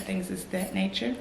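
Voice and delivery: normal talking voice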